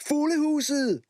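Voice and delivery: high eager shout; excited shout. A narrator's reading. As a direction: blurt it out first. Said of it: "råbte Martin".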